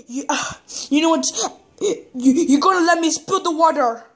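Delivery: usual speaking voice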